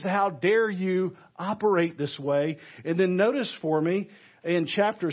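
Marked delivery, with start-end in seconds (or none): none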